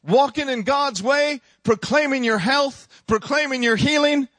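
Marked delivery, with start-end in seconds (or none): none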